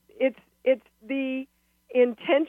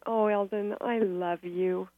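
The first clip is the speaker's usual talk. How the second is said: soft and slow, meaning every word; hushed, loving and sincere; tender; 8 dB quieter than usual. Shown as a demonstration. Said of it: "So I can say".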